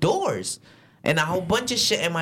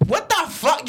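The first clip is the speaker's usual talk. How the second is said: high-pitched retold outrage; mad outburst; 12 semitones higher than usual. Driver told again not to talk, mad outburst. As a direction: blurt it out in exasperation, pitch leaping up. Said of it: "that just got me mad".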